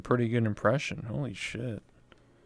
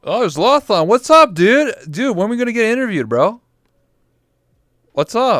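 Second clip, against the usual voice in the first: calling out; 12 semitones higher, 11 dB louder than usual. Real, not acted. Calling out to friend across room.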